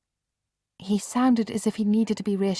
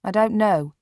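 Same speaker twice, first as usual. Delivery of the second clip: shortly; 4 semitones higher, 7 dB louder than usual. Acted. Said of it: "said Mark shortly".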